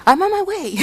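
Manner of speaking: high, loud exasperated "what, what, what"; imitating an annoyed caller. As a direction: mimic an annoyed man rapidly cutting off a caller. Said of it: "And then he says".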